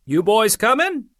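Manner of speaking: a lightly voiced soldier yelling; a shout through the window; soldier calling out. A narrator's reading. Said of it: "a soldier yelled".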